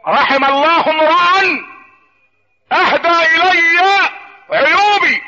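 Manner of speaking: very slow, high pulpit declamation; declaimed saying. Acted. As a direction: ring out each phrase, long and high, with pauses. (ترتيل خطابي عالٍ بطيء)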